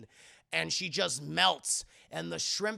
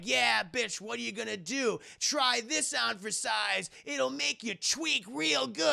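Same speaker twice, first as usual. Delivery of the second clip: taunting; 6 semitones higher than usual. Retold or imitated. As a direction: gloat over the trapped monster. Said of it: "And he's like".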